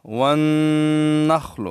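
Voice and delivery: وَالنَّخْلُ with a long nasal hum on the doubled noon; held ghunnah. Shown as a demonstration. Quran teacher showing students the ghunnah, held nasal noon. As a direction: stretch the noon of والنخل through the nose, slowly. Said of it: "ان میں غنہ ہے".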